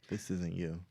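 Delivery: ordinary delivery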